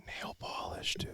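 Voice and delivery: hushed, voiceless emphasis; whisper. Real, not acted. Host stressing an absurd detail to co-host, whispered. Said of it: "Why are we whispering?".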